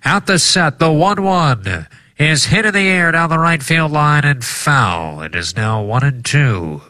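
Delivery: high, sing-song vintage broadcaster; old-timey radio voice. Acted. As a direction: call the pitch like a 1940s radio man. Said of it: "I'm gonna do an old-timey radio voice".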